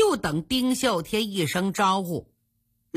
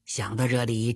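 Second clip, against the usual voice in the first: ordinary delivery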